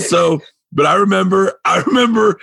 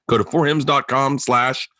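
normal talking voice

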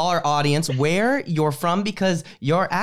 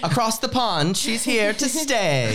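higher, playful announcer line; mock announcer; 8 semitones higher than usual. Host introducing the guest from England, mock announcer.

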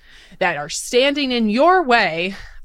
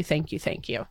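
fading thanks; 7 semitones lower, 11 dB quieter than usual. Real, not acted.

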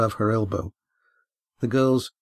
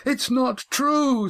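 high, slow, whining denial; boy denying the accusation, high and slow; frightened boy; slower than usual. Acted. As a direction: plead like a scared boy caught out. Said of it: "said Yan".

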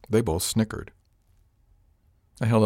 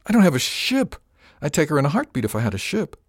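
higher, louder burst of frustration, pitch jumping up; exasperated outburst; 8 semitones higher, 4 dB louder than usual. A narrator's reading. Captain snaps back at Gwen about not having a ship, exasperated. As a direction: blurt it out, fed up with everyone pushing.

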